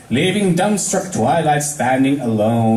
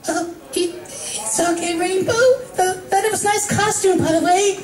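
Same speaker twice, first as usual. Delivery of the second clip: Fluttershy, timid and shaky; shaken Fluttershy. Acted. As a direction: reassure her though still scared. Fluttershy reassures, still shaken.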